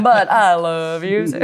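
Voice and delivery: laughing and drawn out; slow, giggly reply. Real, not acted. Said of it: "I'm a hot mess over here".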